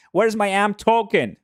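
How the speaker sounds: loud, high call that falls at the end; high-pitched playful shout. Real, not acted. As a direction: holler it as if hunting for something lost. Mock calling out for his token.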